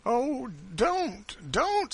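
high, alarmed woman's plea; anxious, high-pitched protest; startled plea. A narrator's reading. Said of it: "looking surprised".

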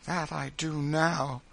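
drawn-out sorrow; despairing lament. A narrator's reading. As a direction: lament slowly in weary despair. Suffering woman's despairing lament.

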